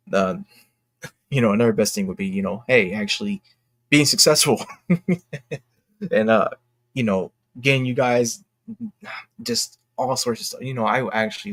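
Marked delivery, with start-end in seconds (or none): 4.7-5.6 high, light run of chuckles; self-amused chuckle. Real, not acted. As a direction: chuckle at your own wish. Laughs at own wish for success.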